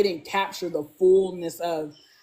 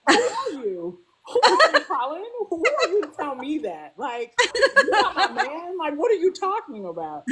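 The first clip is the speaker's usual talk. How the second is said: high, laughing, indignant squeal; mock-offended, laughing through it; high laughing outrage at her man; sassy indignant [name]; 8 semitones higher than usual. [name] snapping back at Colin.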